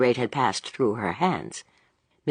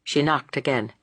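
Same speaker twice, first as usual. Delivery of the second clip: plain reading voice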